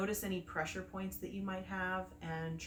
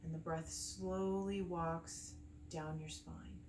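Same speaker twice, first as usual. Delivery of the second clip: calm meditation guide with long pauses between phrases; unhurried, soothing breathwork instruction; guided meditation; 4 dB quieter than usual. Acted. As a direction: gently lead them through the breathing, leaving space after each phrase.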